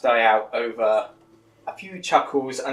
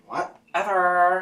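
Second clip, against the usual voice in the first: bored dismissal; 6 semitones higher, 5 dB louder, much slower than usual. Acted. Reluctant girl dismissing.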